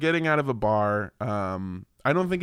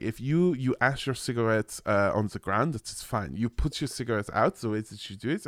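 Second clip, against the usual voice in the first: put-on French accent drifting into German, lower; botched French accent; 5 semitones lower, 4 dB quieter than usual. Frenchman explaining cigarette disposal, botched French-German accent. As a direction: imitate a Frenchman, slipping toward a German accent. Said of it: "more German right now than French".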